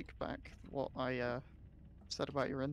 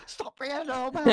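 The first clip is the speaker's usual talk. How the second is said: mock shout; 10 semitones higher, 6 dB louder than usual. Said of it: "and I just said".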